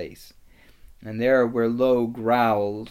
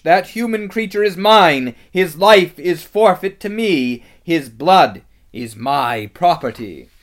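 high, loud villainess claim; piercing, commanding witch voice; possessive witch; 7 semitones higher, 14 dB louder than usual. A narrator's reading. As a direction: declaim it imperiously, dropping on the last words. Witch claims her victim.

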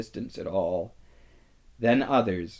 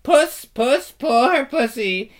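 very high, sing-song taunting; jeering; 11 semitones higher, 5 dB louder, slower than usual. A narrator's reading. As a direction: coo at him like a cat to mock. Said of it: "jeering at him".

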